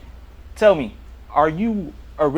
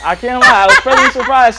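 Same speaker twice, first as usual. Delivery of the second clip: bursting into loud, higher laughter mid-sentence; laughing; 8 semitones higher, 9 dB louder than usual. Real, not acted. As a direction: laugh through it, genuinely amazed. Host reacts to her rapping.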